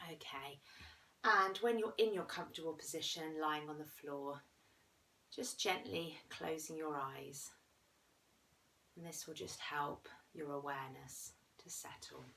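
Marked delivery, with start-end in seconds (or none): 2.0-12.2 guided meditation. Acted. Guiding listeners lying down through a body scan, calm meditation voice.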